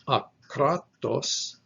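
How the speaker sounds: akratos stressed on KRA in the middle; stress on middle syllable. Shown as a demonstration. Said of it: "from the middle syllable to the last syllable".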